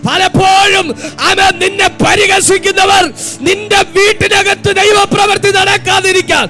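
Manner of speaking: preaching that climbs into a sustained high shout; fervent, shouted prophetic declaration; full-force preaching. Real, not acted.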